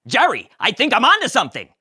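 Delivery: high, loud excited Kramer. Acted. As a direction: burst in with a big idea. Kramer bursts in.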